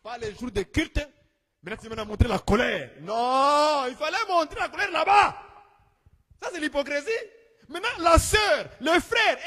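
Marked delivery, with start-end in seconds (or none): 3.0-9.4 preaching shout. Acted. Preaching at full force.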